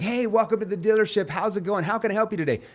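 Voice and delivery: loud, high, over-eager salesman greeting; hammed-up car salesman; pushy car salesman. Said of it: "used car salesperson persona".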